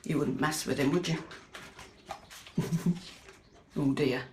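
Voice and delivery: usual speaking voice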